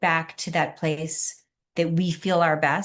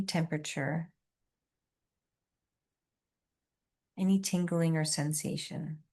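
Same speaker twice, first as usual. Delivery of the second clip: soft, hushed relaxation guide; soothing massage murmur; 6 dB quieter than usual. Acted.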